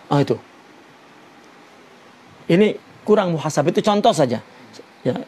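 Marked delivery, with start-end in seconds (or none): none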